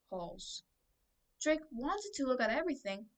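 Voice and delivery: ordinary delivery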